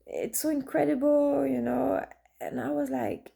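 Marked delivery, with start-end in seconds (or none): 0.1-1.5 much higher, breathless with emotion; overwhelmed friend. Retold or imitated. Grieving friend writing back, overwhelmed and near tears. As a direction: gush like someone almost crying with joy. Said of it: "she's, like, almost crying".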